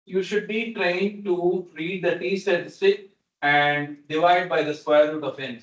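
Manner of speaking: ordinary delivery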